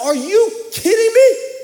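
high, loud, stretched-out disbelief; retold exasperated "are you kidding me". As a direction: shriek it like you can't believe it. Retold disbelief at finding keys.

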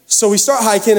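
normal talking voice

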